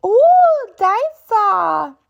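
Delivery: put-on very high wondering toddler coo; slow, high baby voice; toddler George. A narrator's reading. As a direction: coo it like a tiny piglet in awe.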